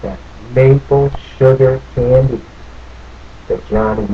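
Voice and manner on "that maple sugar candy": usual speaking voice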